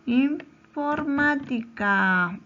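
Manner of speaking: Informática very slow, in, pause, formática; slow word demonstration. Shown as a demonstration. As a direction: sound out Informática slowly with a break. Teacher reads word out by syllables.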